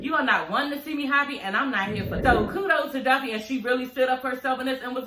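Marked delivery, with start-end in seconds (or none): none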